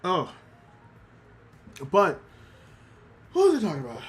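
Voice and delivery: usual speaking voice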